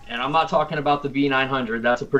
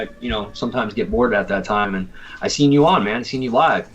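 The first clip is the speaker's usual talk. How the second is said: normal talking voice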